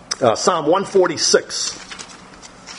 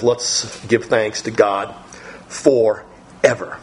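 slow thanks; 5 semitones lower, much slower than usual. Retold or imitated. Closing line of the psalm.

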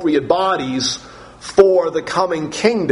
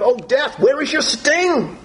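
exultant taunt; 10 semitones higher than usual. Acted. Dramatic scripture reading of taunt.

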